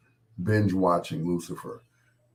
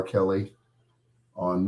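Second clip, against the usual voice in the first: ordinary talk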